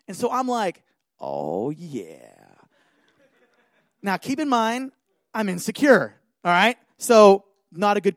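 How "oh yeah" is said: very low, drawn-out smugness; smug relish. Retold or imitated. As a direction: savour it like a would-be ladies' man. Acting smug at prospect of girls.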